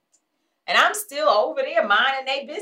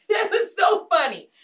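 laugh, then high-pitched delight; tickled, raised voice after a laugh; amused laughter; 8 semitones higher than usual. Real, not acted. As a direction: burst out laughing and let the words ride up high. Laughs while recalling the exchange.

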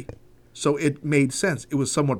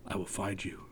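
low hushed menacing growl; quiet threat, lightly Neeson; Liam Neeson impression; 7 semitones lower, 19 dB quieter than usual. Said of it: "What did, uh, Liam Neeson say?".

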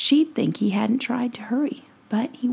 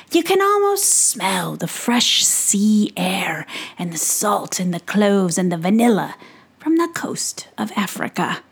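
enchanting peddler; 4 dB louder than usual. Acted. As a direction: draw the boy in with wonders, savouring each place. Peddler spins a tale.

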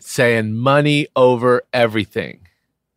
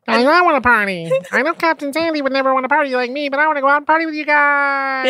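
much higher, fast squeaky little guy; tiny Glenn voice; 12 semitones higher, faster than usual. Acted. Teasing short Glenn, high squeaky voice.

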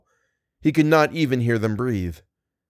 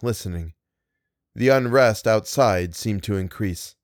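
usual speaking voice

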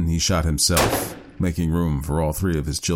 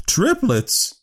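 high, louder exclamation of surprise; exclaiming; 3 dB louder than usual. A narrator's reading. Woman hearing of the triplets, high exclamation. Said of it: "She was exclaiming over the legal implications".

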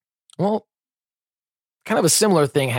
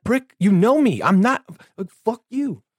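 mock dog owner; 7 semitones higher than usual. Imagined owner yelling at bitey dog.

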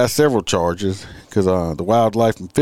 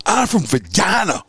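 higher mock boast, hammed up; mock tough holler; 11 semitones higher than usual. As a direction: throw it out like a challenge.

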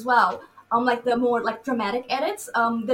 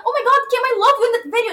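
gushing to a friend; 10 semitones higher, 7 dB louder, much faster than usual. Retold or imitated. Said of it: "I'm like".

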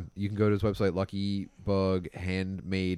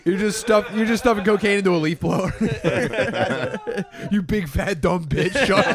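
high, loud, cracking up; laughing mock insult; 12 semitones higher, 6 dB louder than usual. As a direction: insult them through laughter.

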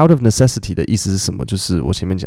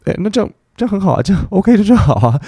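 cheery rushed okay; 10 semitones higher, faster than usual. Retold or imitated. Acting how she'd cheerfully take OK.

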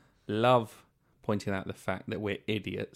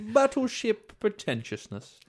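pompous announcement; 10 semitones higher, slower than usual. Pretend. Mocking pomposity, dramatic voice.

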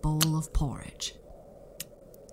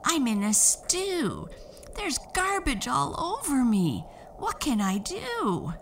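put-on messy girl crying out; 10 semitones higher than usual. A narrator's reading. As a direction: wail it like a grubby child. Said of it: "she cried".

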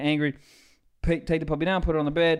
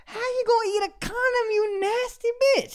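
high, whining scold at the dog; high crying scold; mock scolding whine; 14 semitones higher than usual. Real, not acted. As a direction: scold her like a cry. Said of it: "scolding the dog".

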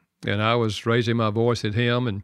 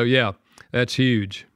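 usual speaking voice

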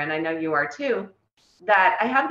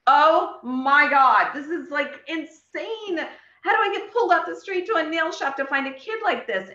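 higher pitched amazed outburst; stunned disbelief; 8 semitones higher than usual. Retold or imitated. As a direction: gasp out the disbelief. Stunned exclamation at discovery.

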